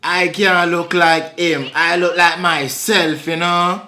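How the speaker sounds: put-on Jamaican patois; Jamaican accent. Disputing a lookalike in a Jamaican accent. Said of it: "You just did that accent".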